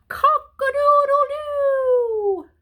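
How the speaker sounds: loud, high, slow cock-a-doodle-doo; rooster crow. Acted. Reading to kids, a loud rooster call. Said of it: "his loud call".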